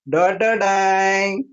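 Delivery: voiced music sting, last note held long; dramatic fanfare. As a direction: sing a mock reveal jingle. Mimics dramatic music sting for thali.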